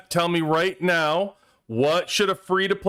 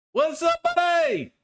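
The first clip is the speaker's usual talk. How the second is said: squeaky greeting; slower than usual. Pretend. Greeting viewer Killer B.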